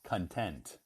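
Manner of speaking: "Content" with the weight on TENT; stress on second syllable. Shown as a demonstration. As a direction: model "Content" as con-TENT, first syllable reduced. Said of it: "the emphasis on, on the second syllable".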